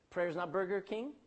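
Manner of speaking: normal talking voice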